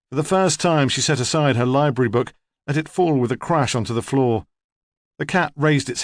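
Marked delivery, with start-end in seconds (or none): none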